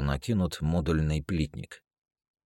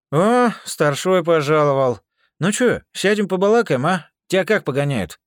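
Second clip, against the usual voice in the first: russian prison slang; 13 semitones higher, 10 dB louder than usual. Acted. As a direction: play a hardened con showing off. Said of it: "меня несло дальше".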